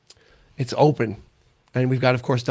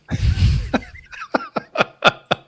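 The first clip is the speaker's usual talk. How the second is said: high, breathy gobbling noise; turkey gobble. Pretend. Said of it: "My turkey impression is almost good".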